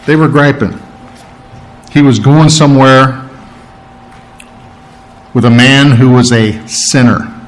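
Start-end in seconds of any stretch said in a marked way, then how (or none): none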